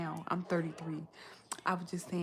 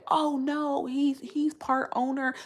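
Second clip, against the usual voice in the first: mocking the critics; 8 semitones higher, 7 dB louder, much slower than usual. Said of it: "But then people was like".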